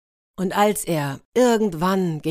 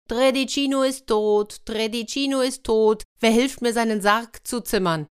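much higher, called-out lament; calling loudly; 5 semitones higher than usual. Acted. Tredicino calls out his own death to lure the wolf, loud. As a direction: cry it out into the forest. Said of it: "rief er laut".

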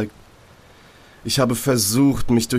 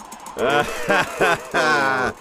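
inner voice laughing; 4 semitones higher than usual. Acted.

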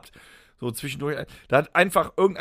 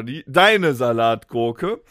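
usual speaking voice

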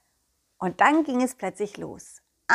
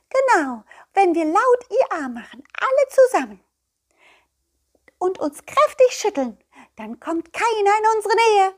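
high, loud eager explaining; excited nettle; 12 semitones higher, 5 dB louder than usual. Acted. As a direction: proudly reveal the secret trick. (hoch, laut und eifrig erklärt)

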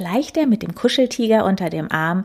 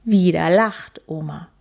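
plain reading voice

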